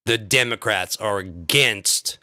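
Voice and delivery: ordinary delivery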